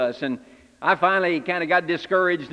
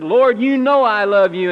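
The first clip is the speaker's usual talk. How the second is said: firm declaration; 4 semitones higher, 9 dB louder than usual. Retold or imitated. Peter answers looking him straight.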